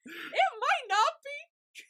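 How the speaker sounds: playful protest. Real, not acted. Argues back through laughter.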